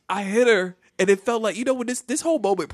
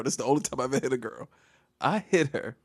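normal talking voice

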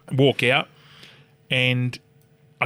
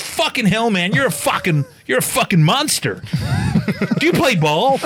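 higher, louder mock-awed outburst; amazed exclamation; 10 semitones higher, 5 dB louder than usual. Shop fella marvelling at the size of a customer, amazed. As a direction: gush in loud, high-pitched amazement.

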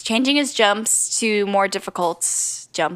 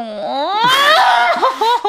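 loud, high laughing cry; high hammed-up swoon; high swooning wail; 4 dB louder than usual. Mock swoon over his skating.

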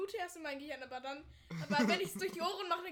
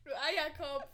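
normal talking voice

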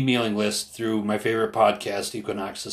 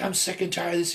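imitated yelling; 9 semitones higher than usual. Older protester venting at younger man, mock yelling. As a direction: retell his fed-up outburst in a raised pitch. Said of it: "he was just yelling".

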